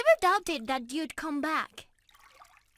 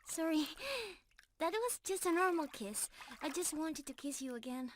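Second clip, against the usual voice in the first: hushed intimate; 2 semitones lower, 8 dB quieter than usual. Real, not acted. Intimate moment before kiss.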